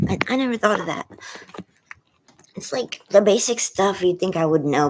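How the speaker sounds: usual speaking voice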